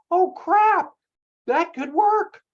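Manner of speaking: high, loud sudden "oh crap" as the idea hits; shocked realisation. Retold or imitated. Listener imagining the offer, startled that it could work. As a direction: blurt it out like a viewer caught off guard. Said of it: "Does anybody else not go".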